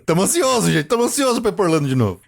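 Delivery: agudo e animado, rápido no fim; empolgação de quem vai viajar; excited. Real, not acted. Empolgação com a viagem. (animado e agudo)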